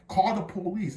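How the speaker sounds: exasperated command; retold anger. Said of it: "Like, I was mad".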